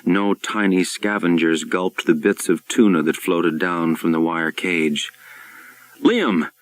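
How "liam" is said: a high urgent shout of his name. A narrator's reading. Darling shouting a warning to Liam over the mic. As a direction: call out sharply in alarm. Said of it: "Darling shouted into the microphone".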